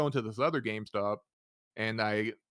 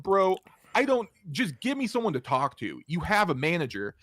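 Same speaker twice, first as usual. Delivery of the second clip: frustrated demand; 6 semitones higher, 4 dB louder than usual. Retold or imitated. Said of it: "I said".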